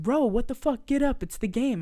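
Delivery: mock bro yell. Friends wake Channing for the game, loud bro voice. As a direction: rouse him loudly like a teammate.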